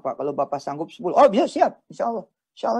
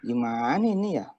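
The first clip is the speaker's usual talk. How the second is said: whiny prayer; 5 semitones lower, 4 dB quieter than usual. Retold or imitated. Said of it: "doa juga kayak begitu".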